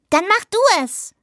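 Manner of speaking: put-on high, eager child's voice; high-pitched excited demand; eager urging. A narrator's reading. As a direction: urge her on in a high voice. Child urges the girl to act.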